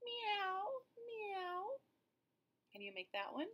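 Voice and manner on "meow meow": much higher mewing cat; cat meow. Shown as a demonstration. Teacher showing children the cat sound, high meow. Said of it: "The cat says".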